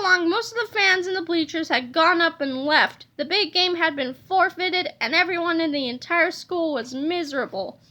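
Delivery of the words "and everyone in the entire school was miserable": usual speaking voice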